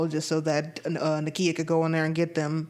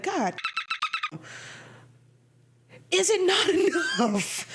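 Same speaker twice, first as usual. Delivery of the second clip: high-pitched laughing exasperation; breaking into laughter mid-question; laughing disbelief; 12 semitones higher than usual. Retold or imitated. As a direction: laugh through the outrage at the film. Exasperated reaction at the film.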